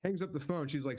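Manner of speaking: normal talking voice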